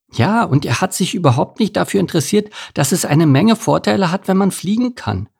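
high, lilting bird voice; high-pitched huffy thrush; thrush character, indignant. A narrator's reading. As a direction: complain in a small bird's high voice.

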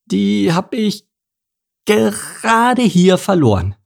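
high, slow, drawn-out fib; nervous excuse. A narrator's reading. Mike lying to the police officer, high and slow.

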